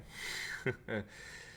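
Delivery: a quick airy chuckle; soft breathy laugh. Real, not acted. Amused by the crypto crowd, soft breathy laugh. Laughs after quoting swear.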